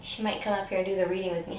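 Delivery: normal talking voice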